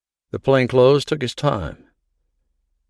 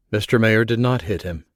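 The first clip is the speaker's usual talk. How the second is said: ordinary delivery, nothing marked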